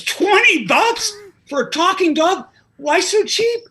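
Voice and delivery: slow, high, loud mock outrage at a price; incredulous. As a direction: play it stunned, stretching each word high. Joke's man shocked at low price.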